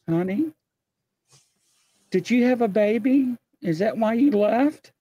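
high, sweet pet-name voice; hammed-up doting question; mock-tender honey. Guy greets returning Bigfoot.

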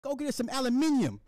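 high put-on British voice; hammed-up high British request; mock British accent. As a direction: pretend to be a Brit asking the wife, pitched high. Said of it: "imagine me telling the wife".

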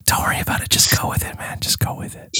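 very soft coaxing whisper; hushed, breath-only coaxing; ASMR whisper. Pretend. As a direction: whisper reassurance into the mic. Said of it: "It's A S M R".

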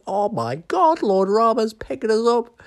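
high, loud, thrilled squeal; the little squirrel overjoyed as Lord Rama picks it up; excited squirrel. Retold or imitated. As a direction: squeak with joy at being lifted. Squirrel thrilled being picked up.

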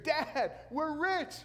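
hammed-up whoop to his father about the catch; mock excited scream. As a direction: yell it like you just struck it rich. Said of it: "What do you think he screamed?".

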